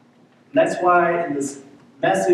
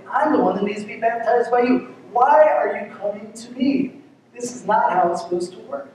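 higher, bewildered protest, as if it makes no sense; confused protest; 6 semitones higher than usual. Retold or imitated. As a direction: ask it in disbelief, pitched up. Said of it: "he's confused by this".

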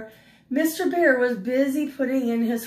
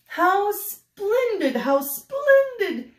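much higher, slow delighted cry; gleeful; 10 semitones higher, much slower than usual. Acted. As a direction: burst out in glee, stretching it. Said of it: "danced in glee".

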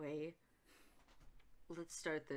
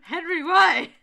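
high, loud, laughing wail; hammed-up dismay; 8 semitones higher, 17 dB louder, slower than usual. Hyde demanding why Henry drank poison, wailing shout. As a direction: yell it in mock despair.

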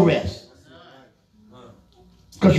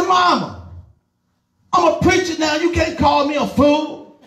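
protesting son; 10 semitones higher than usual. Retold or imitated. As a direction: plead your new status to your mother, half laughing. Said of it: "I said".

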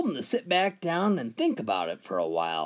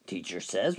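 ordinary talk

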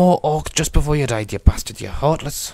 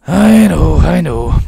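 loud exclamation; 2 semitones higher, 9 dB louder, slower than usual. Pretend. Player answering game character, loud exclamation. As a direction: belt it out, then drop in pitch.

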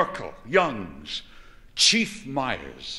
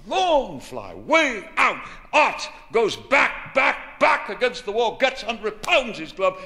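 higher and louder, calling the catch as it happens; excited play-by-play; 7 semitones higher, 3 dB louder than usual. Acted. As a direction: rise with the ball, higher and louder, back to the wall. Calling a long fly ball.

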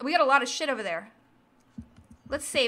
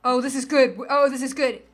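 louder delighted discovery; louder excited find; 6 dB louder than usual. Real, not acted. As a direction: gush over the find. Finds loot.

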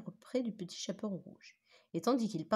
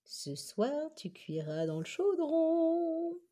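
higher, slow relish of the threat; gloating; 9 semitones higher, much slower than usual. A narrator's reading. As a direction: savor the victory over the hen. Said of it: "se réjouit le renard".

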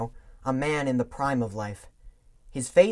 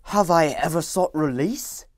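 put-on loud, raised young Scrooge; bitter young man; 6 semitones higher, 9 dB louder than usual. A narrator's reading. Young Scrooge to his fiancée, loud and bitter. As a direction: declaim it like a man defending his greed.

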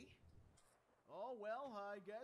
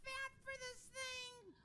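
high silly voice; 14 semitones higher, 4 dB louder, slower than usual. Acted. Dubbing a character.

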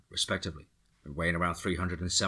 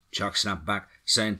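ordinary delivery, nothing marked

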